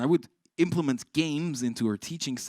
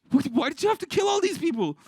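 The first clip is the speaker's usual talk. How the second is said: high, alarmed disbelief; shocked high; 5 dB louder than usual. Retold or imitated. Teacher shocked at the girl's drawing, high and alarmed. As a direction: ask in horrified surprise.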